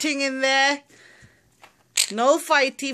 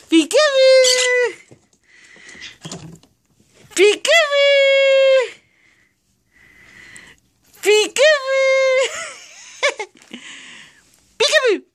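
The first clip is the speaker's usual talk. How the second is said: playful peekaboo; 7 semitones higher than usual. Pretend. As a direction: sing it out high for the bird. Playing peekaboo with bird.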